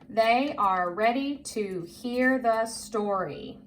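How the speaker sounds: slow, word by word; careful, spaced-out read-along voice; model reading. Shown as a demonstration. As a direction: read it out slowly for a learner to follow. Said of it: "My turn".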